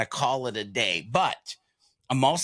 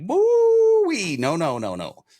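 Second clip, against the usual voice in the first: excited exclaim; 9 semitones higher, 6 dB louder, slower than usual. Retold or imitated. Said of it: "And I'm like".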